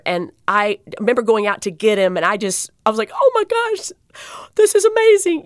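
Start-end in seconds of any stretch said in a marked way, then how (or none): 3.1-5.4 high-pitched, thrilled gasp of delight; squealed amazement; amazed exclamation. Retold or imitated. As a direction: relive the delighted shock, voice way up. Retelling her amazed reaction to patient.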